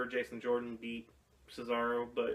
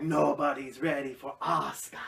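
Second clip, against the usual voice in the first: whispering; 5 dB louder than usual. Retold or imitated. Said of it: "She'd be like".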